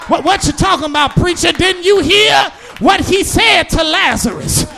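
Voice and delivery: loud, rhythmic whooping preacher cadence; preaching at full force. Acted. Preacher to his congregation, preaching at full force. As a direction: drive each line home to the pews.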